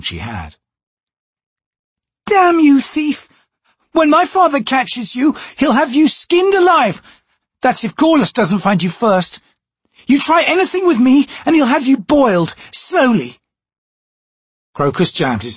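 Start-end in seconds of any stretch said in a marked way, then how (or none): 2.3-13.4 put-on a much higher, louder furious girl's tirade; furious noblewoman. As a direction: spit threats at the thief. Chalice furious at captor.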